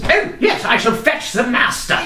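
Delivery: high, eager servant voice. Acted. GM voicing the servant greeting the player. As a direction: chirp it like a fussing servant.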